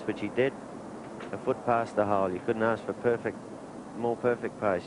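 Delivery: usual speaking voice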